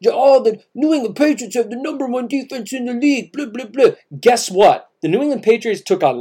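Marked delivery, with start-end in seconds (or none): 0.1-3.9 high, mocking parroting of the hype; fed-up mimicry. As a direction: parrot the praise dismissively in a raised voice. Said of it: "so tired of hearing about".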